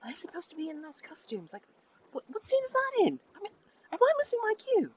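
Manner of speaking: very high-pitched, squeaky confused whispering; high squeaky mock whisper; mock stage whisper. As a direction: pitch it way up like puzzled actors whispering. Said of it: "they'll whisper to each other".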